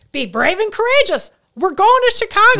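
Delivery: loud, high, thrilled "Be brave and courageous"; the husband seizing on the verse as a go-ahead; fired-up husband. Retold or imitated. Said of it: "So my husband's like".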